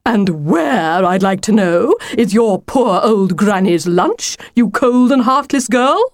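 lightly voiced reproachful wolf; dismayed wolf, accusing. Wolf asking Lucy where granny's lunch is, accusing. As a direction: accuse her, rising on the final insult.

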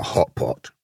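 low, resigned wolf; wolf's voice. A narrator's reading. Wolf telling the lamb what she is, low-voiced. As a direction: drop the name low in pitch.

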